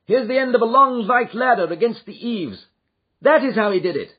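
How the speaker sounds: an eager, commanding Holmes; eager detective. Acted. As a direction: urge them on to the chase. Holmes on the roof.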